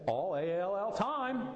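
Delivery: "All" spelled letter by letter, climbing to a high "time"; rising emphasis from "All" up to "time"; emphatic spelling out. Real, not acted. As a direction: hammer home "All", then lift "time" high. Spells out the key word for emphasis.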